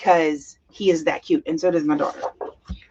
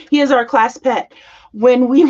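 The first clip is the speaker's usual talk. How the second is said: usual speaking voice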